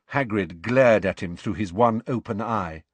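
ordinary delivery, nothing marked

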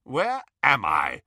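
slow, emphatic indignant demand; insistent repeat. A narrator's reading. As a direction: spell out the question slowly, demanding. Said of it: "repeated Phineas Nigellus".

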